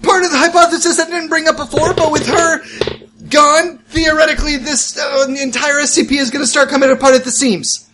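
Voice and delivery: high, brisk briefing voice; lightly voiced agent. Character addresses team in play.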